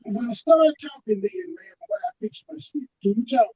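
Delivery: muffled, drowned-out audio; underwater. Real, not acted. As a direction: sounds like he's drowning. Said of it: "you sound like you drowning again".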